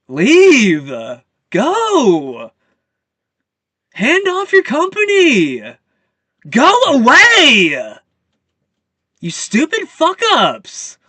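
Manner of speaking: screaming high and loud at them to leave; full-throated rage, yelling them out; furious shouting. Real, not acted. Ranting vlogger screams at the elites to leave. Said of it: "Sorry if I'm getting loud".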